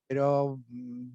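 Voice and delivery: ordinary delivery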